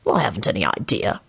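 low-pitched irritable reply. A narrator's reading. Lupin snubbing the hints he was given, irritable. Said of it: "Lupin replied irritably".

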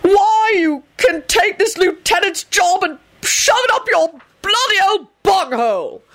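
screeching resignation; furious screaming. A narrator's reading. Arthur's screamed quarrel goes on.

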